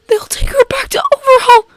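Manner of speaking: high, tearful whisper; terrified whisper. Acted. Midoriya whispering his fear for Eri, crying. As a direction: whisper it through tears. Panic attack beginning.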